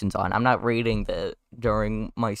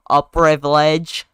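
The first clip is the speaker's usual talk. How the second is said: southern drawl; 4 semitones higher, 6 dB louder, slower than usual. Acted. Lawyer answers slowly.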